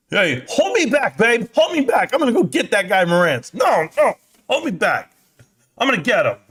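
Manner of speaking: high, wailing mock plea to be held back; mock fight bravado. Pretending to babe he must be restrained, mock-frantic bravado. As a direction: put on a show of straining to fight.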